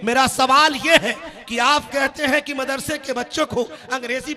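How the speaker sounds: driving, insistent sermon oratory; forceful preaching. Real, not acted. Preacher challenging critics of madrasa schooling, forceful preaching. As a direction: hammer each repeated challenge at the congregation.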